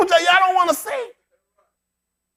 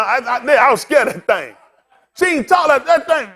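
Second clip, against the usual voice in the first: ordinary delivery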